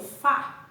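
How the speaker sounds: "fá" said alone with extra force; forceful stressed syllable. Shown as a demonstration. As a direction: punch out "fá" as the strong syllable. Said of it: "Eu falo com mais força". ("fá" dito com mais força)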